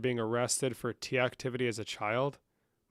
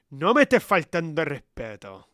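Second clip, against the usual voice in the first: louder, stern Spanish repeat of No me estés faltando el respeto; loud scolding; 3 semitones higher, 9 dB louder than usual. Acted. Telling Sofía off a second time, much louder. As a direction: raise the voice to put Sofía in her place.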